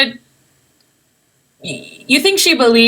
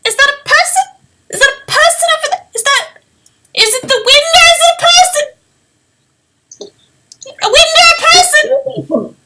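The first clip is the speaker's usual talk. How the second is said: very high, fast squeaky little voice; a tiny unseen creature peering out; a small hidden shopkeeper calling from the piles; faster than usual. Acted. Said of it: "you hear a little voice go".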